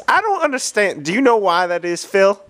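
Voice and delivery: high, puzzled question; baffled. Acted. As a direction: ask in mock bewilderment. Puzzled about gas.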